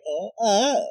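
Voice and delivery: high, exaggerated "oh, oh"; mock flamboyant gasp. Teller mimicking flamboyant women's reactions, high pitched. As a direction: camp it up with fluttery exclamations.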